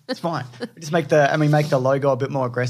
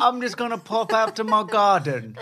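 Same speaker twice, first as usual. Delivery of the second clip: Jamie Oliver impression; 8 semitones higher than usual. Husband joins wife's bit, mock British chef voice. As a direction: send up a British TV cook. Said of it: "I'm Jamie Oliver".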